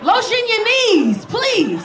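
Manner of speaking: high, drawn-out comic pleading; exasperated plea. Acted. Comedian begging men to lotion their knees, exasperated plea.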